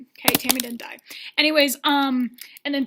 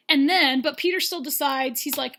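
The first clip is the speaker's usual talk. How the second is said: ordinary delivery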